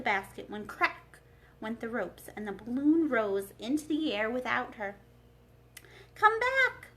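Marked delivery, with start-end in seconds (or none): 6.1-6.9 high, loud scream. Acted. Dorothy screams at Oz as the balloon leaves, loud and high. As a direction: shriek after the rising balloon. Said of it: "she screamed".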